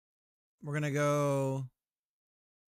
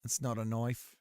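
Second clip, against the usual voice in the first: put-on movie knife line redone in a lower Australian accent; 5 semitones lower than usual. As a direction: lower Australian accent, that's not a knife with not stressed and knife as noif. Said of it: "I didn't say it with the accent".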